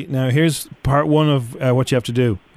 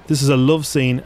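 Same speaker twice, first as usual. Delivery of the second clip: ordinary delivery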